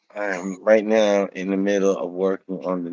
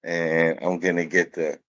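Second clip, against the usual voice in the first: normal talking voice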